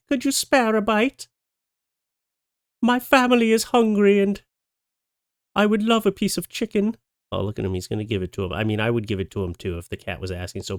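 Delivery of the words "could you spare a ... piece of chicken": high, sweet pleading cat voice; polite begging cat. Acted. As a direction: beg for a bite like a well-mannered cat. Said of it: "politely asking a tourist for some of his food".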